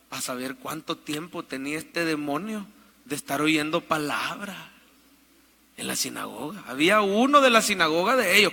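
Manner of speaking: normal talking voice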